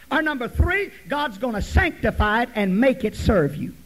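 loud, emphatic counting of points; full-force preaching. Real, not acted. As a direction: hammer each point home to the congregation.

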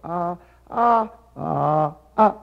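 "ah" four times, each with a different tone; four Mandarin tones. Shown as a demonstration. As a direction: contrast the pitch shape of every "ah". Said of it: "you have to either put".

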